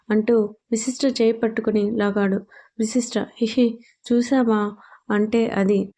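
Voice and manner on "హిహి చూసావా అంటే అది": ordinary delivery, nothing marked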